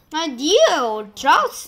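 slow, loud mock endearment; crying out. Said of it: "and Sponge cried".

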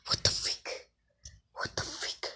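hushed, breathy muttering; whispered confusion. Real, not acted. Lost in the kitchen looking for the oven, whispered. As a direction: whisper it to yourself, baffled.

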